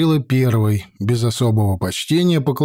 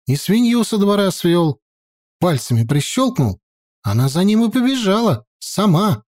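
higher, bold peasant woman voice; feisty village woman; 7 semitones higher than usual. A narrator's reading. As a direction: push back boldly, higher. Woman explains.

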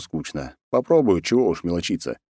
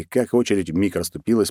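ordinary delivery, nothing marked

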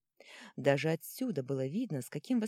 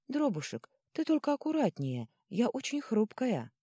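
gingerly coaxing; 8 semitones higher than usual. Acted. Girl asks the troll to be careful, high coaxing. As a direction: coax him in a high, careful voice.

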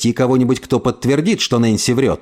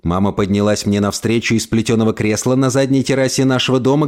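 plain reading voice

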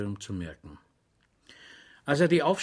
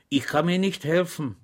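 earnest; 5 semitones higher, 3 dB louder than usual. A narrator's reading. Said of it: "antwortete Schweig ernst".

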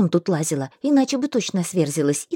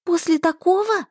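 disbelieving; 8 semitones higher than usual. Acted. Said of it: "не поверила я своим ушам".

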